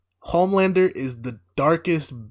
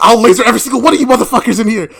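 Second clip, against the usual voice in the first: loud, high, rushed mock rage; hammed-up furious threat with laughter in it; snapped threat; 8 semitones higher, 10 dB louder, much faster than usual. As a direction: imitate a man losing it at a crowd. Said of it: "he snaps and says".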